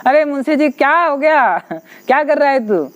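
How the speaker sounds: lightly voiced loving father asking; affectionate father. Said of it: "बड़े प्यार से कहते हैं". (प्यार से पूछते पिता)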